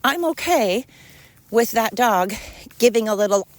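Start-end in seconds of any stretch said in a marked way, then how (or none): none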